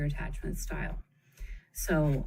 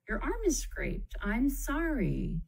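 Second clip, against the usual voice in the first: loving parent; 8 semitones higher, slower than usual. Shown as a demonstration. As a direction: gently soothe a kid's scraped arm. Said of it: "cares for the wound in a loving way".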